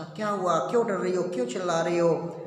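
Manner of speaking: usual speaking voice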